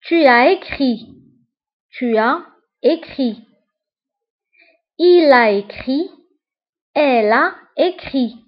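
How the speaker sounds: each form said slowly, the auxiliary set apart; careful classroom recitation; slow model recitation. Shown as a demonstration. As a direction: dictate the forms for the class to repeat. Teacher models passé composé forms.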